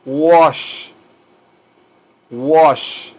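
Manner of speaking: teacher's drawn-out "wash"; model pronunciation. Shown as a demonstration. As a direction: drill "wash" as an isolated, careful word. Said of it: "Se pronuncia así".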